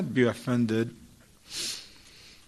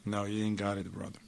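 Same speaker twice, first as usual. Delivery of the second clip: firmer tone; 6 semitones lower, 9 dB quieter than usual. Retold or imitated. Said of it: "now she's gonna change her tone".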